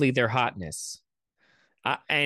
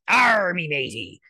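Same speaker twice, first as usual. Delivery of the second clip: mock pirate accent; 4 semitones higher, 4 dB louder than usual. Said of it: "It's a fucked up Scottish accent".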